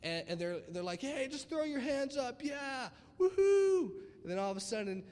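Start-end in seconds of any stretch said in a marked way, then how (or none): 1.0-3.9 high, loud whooping concert crowd call; excited mock concert shout; concert hype. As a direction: hype up the crowd, ending in a long woohoo. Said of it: "you're at a secular concert and stuff".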